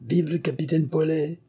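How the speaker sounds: high-pitched cheer at ordinary volume; restrained, high acclamation; cheering cry. A narrator's reading. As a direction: cry it out like a reveller in the street. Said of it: "criait-il".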